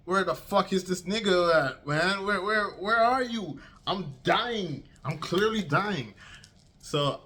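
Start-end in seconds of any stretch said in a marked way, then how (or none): 0.1-6.1 high-pitched, frantic retelling of panic; panicked drowning. Retold or imitated. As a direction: act out frantically searching for your rescuer. Acting out himself panicking while drowning.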